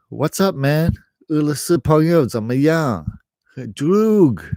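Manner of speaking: high, loud hammed-up tough-guy greeting; mock Russian gangster. Imitating Russian gangsters in Beijing, hammed-up and loud. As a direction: play a swaggering gangster for laughs. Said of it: "the Russians is bad news".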